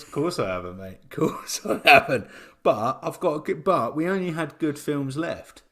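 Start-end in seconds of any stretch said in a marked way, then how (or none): 1.0-2.3 louder, higher insistent repeat; mock-indignant emphasis; emphatic repeat. Real, not acted. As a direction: lean into it the second time.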